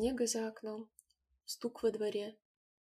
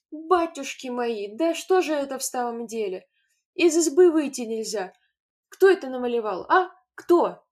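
loud, higher outraged cries; furious; 4 semitones higher, 13 dB louder than usual. A narrator's reading. Mother to the children, furious demand. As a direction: demand who did it. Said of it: "покраснела от гнева".

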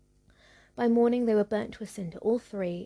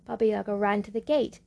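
ordinary delivery

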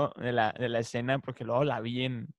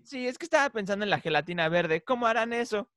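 higher-pitched cartoon kid imitation; Carlitos; 8 semitones higher than usual. Carlitos telling Tommy about green jelly, cartoon imitation. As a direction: wonder aloud in a raised little cartoon voice. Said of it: "o sea, Carlitos es clave".

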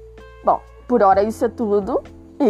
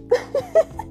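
high giggle. Real, not acted. Laughing at own joke.

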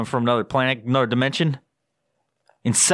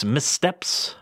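ordinary delivery

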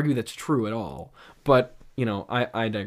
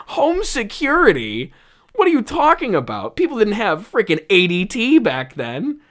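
higher and louder, baffled disbelief; incredulous rant; 8 semitones higher, 5 dB louder than usual. Real, not acted. Reviewer ranting at the silly script, incredulous and loud. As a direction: rant as if the idea is absurd.

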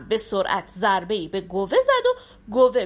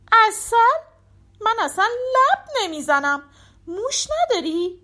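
much higher, louder on the first word; disdainful surprise; 10 semitones higher, 3 dB louder than usual. A narrator's reading. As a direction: scoff at honey, crave mice. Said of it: "گربه گفت".

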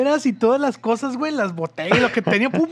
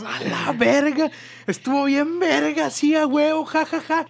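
excited; 5 semitones higher, 3 dB quieter, slower than usual. Retold or imitated. Friends celebrating the escape.

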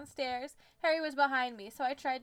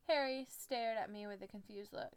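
plain reading voice

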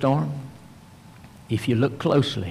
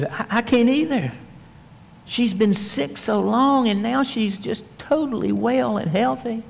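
wife's voice; 9 semitones higher than usual. Acted. Wife answers husband in bed.

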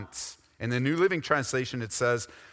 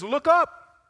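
much higher and louder, a sudden cry; ringing call; 14 semitones higher, 9 dB louder than usual. Acted. Preacher voicing Jesus telling believers to look up, loud. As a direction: lift it like a trumpet call.

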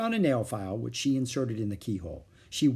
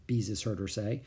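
plain reading voice

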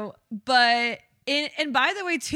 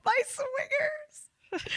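high, slow, wheezing laugh; crying with laughter; 6 dB quieter, much slower than usual. Real, not acted. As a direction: squeal it out through tears of laughter. Listener laughing hard.

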